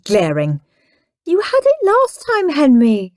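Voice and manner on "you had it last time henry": whiny. Acted. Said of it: "said Perfect Peter".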